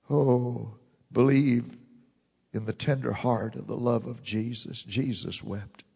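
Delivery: low, soft, slowed "Jesus wept"; hushed tenderness. Real, not acted. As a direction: drop to almost nothing, tenderly. Hushed after the build.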